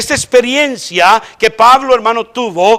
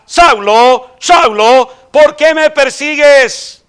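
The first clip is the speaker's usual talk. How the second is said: calling voice of the Lord; 5 semitones higher, slower than usual. Acted. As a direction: call the name twice, then ask slowly and gravely.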